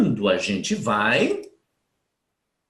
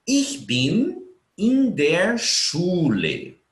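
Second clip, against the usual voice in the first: each German word slow and clear; slow model sentence; 5 semitones higher, much slower than usual. Shown as a demonstration. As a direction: read it out slowly for the class. (frase em alemão dita bem devagar)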